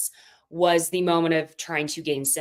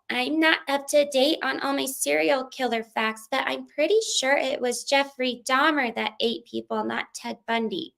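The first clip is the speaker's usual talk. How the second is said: higher-pitched impression of a castmate; quoting a confessional; 6 semitones higher than usual. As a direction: imitate her confessional voice, pitched up. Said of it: "we get Whitney in confessional".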